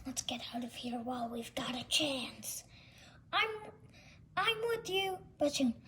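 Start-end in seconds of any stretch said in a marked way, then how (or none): 0.1-2.6 hushed escape whisper; sneaky whisper. Acted. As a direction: whisper it so no one hears. Villains sneaking away.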